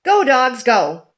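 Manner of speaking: ordinary delivery, nothing marked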